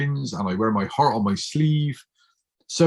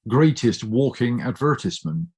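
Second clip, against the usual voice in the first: ordinary talk, nothing marked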